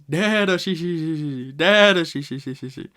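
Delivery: loud, high, booming giant's laugh; Jaguar D Saul's laugh. Retold or imitated. Retelling how Saul teaches Robin to laugh, loud booming laugh. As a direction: belt out a big jolly giant laugh. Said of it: "and he laughs".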